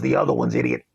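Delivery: very low dog voice; talking dog. Retold or imitated. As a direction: drop deep to voice the dog's retort. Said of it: "The dog goes".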